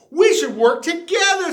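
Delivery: high and loud with a laugh; laughing suggestion. A narrator's reading. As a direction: laugh as you offer to team up. Clayton proposing teamwork.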